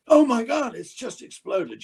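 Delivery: high, loud cry that slows into shock; dramatic alarm. Acted. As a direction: play it big, like the ship just blew. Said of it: "one of those moments".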